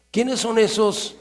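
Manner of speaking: normal talking voice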